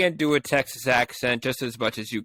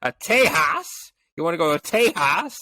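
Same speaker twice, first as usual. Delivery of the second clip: bad Texas accent; 3 semitones higher than usual. Shown as a demonstration. Showing the co-host he can't do it, bad Texas accent. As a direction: try and fail at a Texas accent. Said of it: "that's why I don't do a Texas accent".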